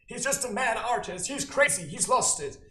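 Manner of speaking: high, put-on dismissive voice; mocking his critics. Mimics people dismissing him as mad.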